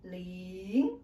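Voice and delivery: "ling" starts low and climbs; rising second tone. Shown as a demonstration. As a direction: draw out "ling", sliding up like a question. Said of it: "start lower and then go up".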